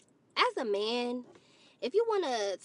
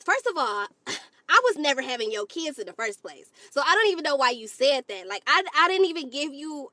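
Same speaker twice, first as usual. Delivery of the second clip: ordinary talk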